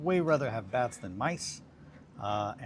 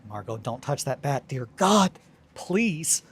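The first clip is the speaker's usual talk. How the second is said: much higher and louder, begging; desperate plea; 5 semitones higher, 11 dB louder, slower than usual. Retold or imitated. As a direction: plead frantically, rising to a yelp on dear God. Pleading with cat over bat.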